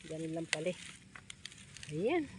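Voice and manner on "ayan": Ayan squeaked high with a rise; high excited "Ayan". Real, not acted. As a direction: pop the Ayan up high, delighted. Branch finally drops.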